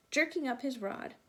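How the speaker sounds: ordinary delivery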